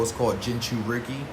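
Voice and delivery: ordinary talk, nothing marked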